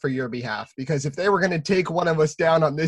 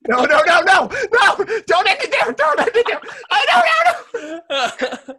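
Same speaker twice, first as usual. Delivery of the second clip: frantic shout; 5 dB louder than usual. Real, not acted. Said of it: "begging not to end show".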